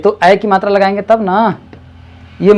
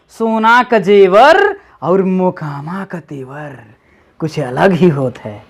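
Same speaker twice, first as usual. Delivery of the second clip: slow, lower Bhojpuri proverb recital; reciting a saying; 6 semitones lower, slower than usual. Shown as a demonstration. Teacher quoting Bhojpuri proverb to child, reciting slowly. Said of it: "Bhojpuri में पढ़, सुने होंगे ना".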